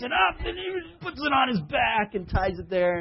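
hammed-up effort under a weight; mock straining. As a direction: act out lifting a load that is too heavy. Peter hoisting a small boulder.

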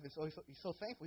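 ordinary delivery

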